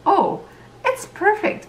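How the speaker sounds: high excited gasp of delight; reliving finding the perfect rock; thrilled discovery. Retold or imitated. Finding a rock at the lake, retold with high delight. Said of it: "I was like".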